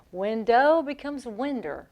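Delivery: "Window" plain, then "winder" ending in R; slow demo turning Window into "winder"; added Southern R. Shown as a demonstration. Host showing how the South talks, "winder" with added R. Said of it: "We add an R to everything".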